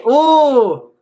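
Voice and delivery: loud high ooh of delight; a teasing ooh at the news; high playful ooh. Real, not acted. Reacts to marriage wish.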